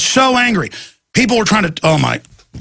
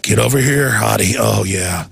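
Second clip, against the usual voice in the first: leering creep; 8 semitones lower than usual. Retold or imitated. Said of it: "And they're like".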